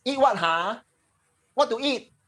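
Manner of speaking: high, terse parent asking; blunt Singaporean parent. Retold or imitated. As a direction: clip it like a busy local parent. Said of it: "your mommy and daddy will ask".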